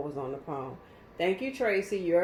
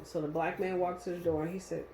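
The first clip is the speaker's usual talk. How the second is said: ordinary talk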